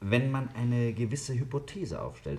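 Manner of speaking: ordinary delivery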